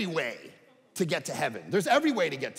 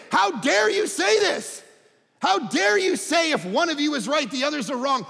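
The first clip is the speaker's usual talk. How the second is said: outraged students; 8 semitones higher, 6 dB louder than usual. Retold or imitated. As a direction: demand it like offended students. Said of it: "line up at the microphones and say".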